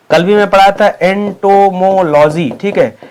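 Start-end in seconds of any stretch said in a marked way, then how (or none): 1.0-2.5 "entomology" broken into long syllables; slow dictation. Shown as a demonstration. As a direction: spell out "entomology" by sound, slowly. Teacher repeats term for learners.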